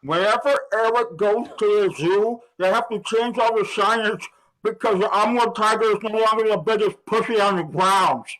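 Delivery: words mangled by a stick in the mouth. Real, not acted. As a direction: talk through a mouthful of stick. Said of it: "you get to put something in your mouth".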